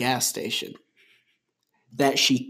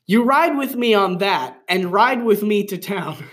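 dramatic reading; 5 semitones higher, 5 dB louder than usual. Acted. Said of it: "skip the little gesturing".

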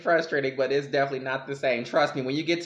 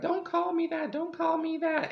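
much higher, whiny don't call me that; high mock protest, slower on the second; mocking whine. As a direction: mimic them, high and prissy. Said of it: "They're like".

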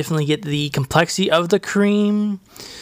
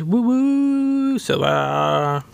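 mock cheer; 6 semitones higher, much slower than usual. Cheering the silver package.

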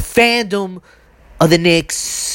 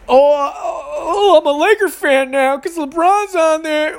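mocking fan voice; 9 semitones higher than usual. Mocking the fan who switched.

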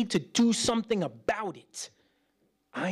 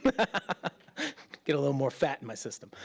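chuckling through the line; laughing; 3 semitones lower, 3 dB quieter than usual. Real, not acted. Pastor joking about burgers, laughing. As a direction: laugh at your own joke.

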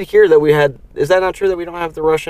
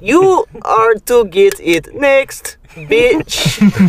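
higher, louder, very slow robot threat; a robot picking a fight; angry robot; 7 semitones higher, 3 dB louder, much slower than usual. Pretend. Robot quarrel.

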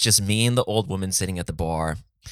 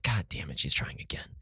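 whispered exasperated aside; muttered under breath; 3 semitones lower, 10 dB quieter than usual. Retold or imitated. Fed up with the old woman, muttering under his breath. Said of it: "I'm like".

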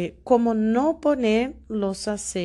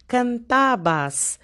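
"Cantabas" slowly, with a long raised TA; teacher's slow "Cantabas", leaning on "ta"; stress on TA; 4 semitones higher, slower than usual. Shown as a demonstration. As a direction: draw out the middle syllable of "Cantabas". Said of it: "la sílaba más fuerte es el ta".

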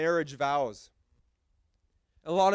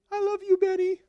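much higher, playful bride voice; mock bride; 3 dB louder than usual. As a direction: jump up high to play the bride answering. Bride voiced after groom.

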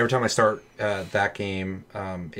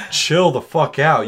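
louder, slow scolding of the PC; half-joking scold at a machine; mock scolding; 6 semitones higher, 9 dB louder, slower than usual. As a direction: tell the computer off. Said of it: "And I'm like".

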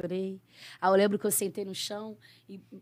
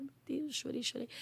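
much softer, hushed prayer at night; hushed crying prayer; 4 semitones higher, 15 dB quieter than usual. Retold or imitated. Woman praying at night so her sleeping husband won't hear, hushed. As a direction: keep it barely audible while the husband sleeps. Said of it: "pra poder chorar melhor".

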